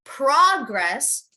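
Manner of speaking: progress stressed on PROG, drawn out slowly; stress on first syllable. Shown as a demonstration. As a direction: hit the start of progress, the noun. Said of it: "emphasize the beginning".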